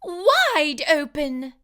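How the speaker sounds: slow, loud, stretched-out yell; wife yelling. A narrator's reading. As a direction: order the jaw to stay open. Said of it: "yelled his wife".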